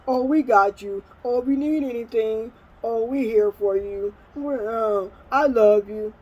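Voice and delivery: mock-caring relatives; mocking false reassurance. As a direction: mimic relatives' gushing promises, pitched a bit up. Mimicking relatives' empty social media promises.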